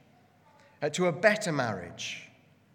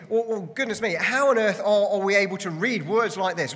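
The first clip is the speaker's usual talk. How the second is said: acted shock, higher and louder; raised, emphatic mock outrage; shocked disbelief; 6 semitones higher, 5 dB louder than usual. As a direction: play an aghast listener's objection. Voices a shocked listener's reaction.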